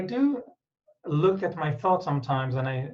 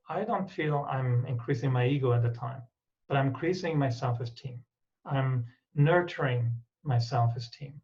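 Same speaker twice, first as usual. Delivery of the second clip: ordinary delivery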